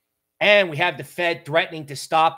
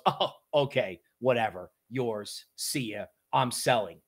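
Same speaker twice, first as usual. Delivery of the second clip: dismissive shrug; 5 semitones lower, 5 dB quieter than usual. Retold or imitated. Bond market shrugs, walks off.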